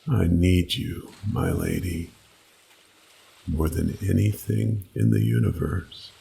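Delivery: ordinary delivery, nothing marked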